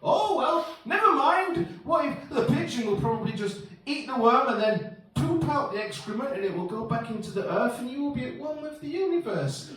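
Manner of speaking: high, airy reassurance; breezy consoler. Acted. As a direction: start very high and soothe away the worry. Consoling friend in acted dialogue.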